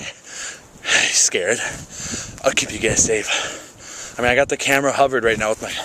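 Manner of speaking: low, hushed, lightly breathy come-on; mock seductive low voice. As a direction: play the smooth protector for the viewers. Flirty low voice to viewers.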